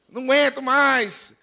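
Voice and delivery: loud, exasperated parody; mock complaining. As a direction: moan about it theatrically. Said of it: "tudo que você não reclamasse".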